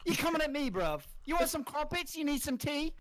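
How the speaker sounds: high, exaggerated British roadman; put-on Brit, pitched well up; hammed-up British bruv talk; British accent. Shown as a demonstration. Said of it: "This guy's really proud of his Brit".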